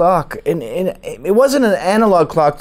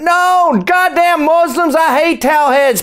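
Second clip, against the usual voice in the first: high, hammed-up mob of kids; hateful kids; 10 semitones higher, 3 dB louder than usual. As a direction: caricature outraged schoolchildren, pitched way up. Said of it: "And all the kids went".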